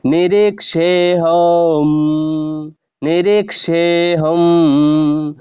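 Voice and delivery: "নিরীক্ষেহম" very slow with the হ drawn long; lengthened ending. Shown as a demonstration. Teacher lengthening the sound for students. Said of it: "এটার উচ্চারণ একটু দীর্ঘ হতে হবে".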